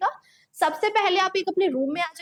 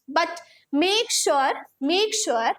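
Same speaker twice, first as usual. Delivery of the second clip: slow emphatic; much slower than usual. Shown as a demonstration. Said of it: "teacher stresses instruction".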